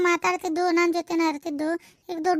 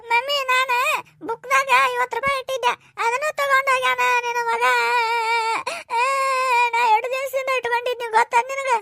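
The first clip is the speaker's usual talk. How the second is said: put-on higher girlish voice, upset; young daughter voice; 3 semitones higher than usual. Daughter complaining to mother about her stolen money, girlish. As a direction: play the teenage girl complaining.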